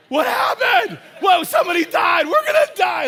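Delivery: very high, loud panicked shouting; hammed-up hysterical yelling; freaking out. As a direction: shriek it in acted total panic. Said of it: "I'm just totally freaking out".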